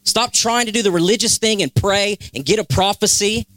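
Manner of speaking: high, urgent preaching plea; God speaking. Acted. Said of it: "And he says".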